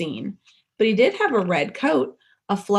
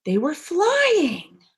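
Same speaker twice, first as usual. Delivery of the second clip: high, slow amazed reveal; wonder; 10 semitones higher, 5 dB louder, much slower than usual. A narrator's reading. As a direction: let the wonder open up. Narrator reveals the magic.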